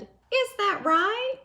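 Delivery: high, slow, polite skunk voice; a curious little animal, drawn out. Acted. Skunk's polite listening reply.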